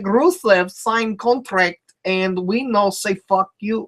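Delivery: put-on slow, deliberately terrible Russian accent; mocking the wrestler in a clumsy fake Russian accent; worst Russian accent. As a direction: ham up a bad Russian accent, dragging the words. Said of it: "trying to do the worst Russian accent possible".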